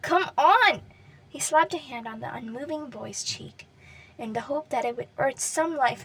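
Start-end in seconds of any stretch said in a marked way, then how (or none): 0.0-0.8 loud, pleading shout to wake him; urgent shouting. Acted. Katsuki yelling at the unmoving boy, loud and desperate. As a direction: yell at him to get up. Katsuki shaking unconscious Deku.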